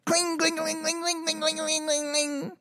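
high, soft, rapid ringing on one note; metallic clinking. Retold or imitated. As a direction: imitate metal skittering across the floor. Imitates metal bouncing on the ground.

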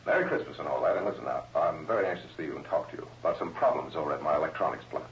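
muffled voice off a tape machine; canned, distant recorded voice; tape recording. Acted. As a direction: sound like a recording played back on a phone line. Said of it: "Pre-recorded here before he left".

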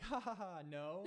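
high laughing dismissal, ha ha ha; mock laugh. Teacher laughing off the question, high mock laugh. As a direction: laugh it off high and loud, then no. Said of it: "And he's like".

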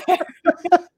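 slow, laughing words; drawn out through giggles. Real, not acted. Laughs about Canadian accent.

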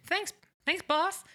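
high, eager put-on voice; voicing Roger. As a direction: thank him like a keen underling. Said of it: "He's like".

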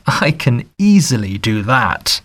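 ordinary delivery, nothing marked

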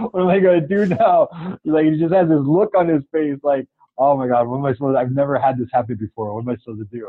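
mock panic. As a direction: play the stunned manager, panicking but amused. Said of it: "he has this look on his face like".

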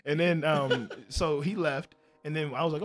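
ordinary talk

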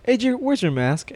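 high-pitched retold challenge; imitated coworker questioning. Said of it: "and then Yuli goes".

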